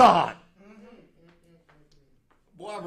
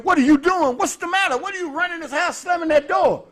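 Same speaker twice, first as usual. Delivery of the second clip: auntie scolding; 8 semitones higher, 4 dB louder than usual. Retold or imitated. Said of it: "my auntie said".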